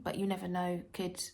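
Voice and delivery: ordinary talk, nothing marked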